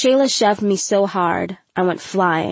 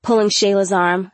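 ordinary delivery, nothing marked

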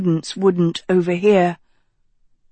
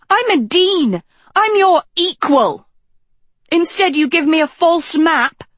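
indignant rant; 8 semitones higher than usual. A narrator's reading. Sophie's outraged complaint.

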